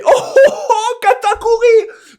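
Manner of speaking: high, drawn-out wailing call of a name; hammed-up sobbing shout; mock tearful cry. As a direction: wail it like a fan overcome. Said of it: "Pour faire".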